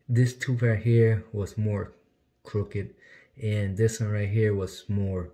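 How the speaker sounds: talking with finger in mouth; finger on his teeth. Real, not acted. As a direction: lip held down by finger. Seen 0: Finger pressed on lower teeth and lip while pointing out tooth.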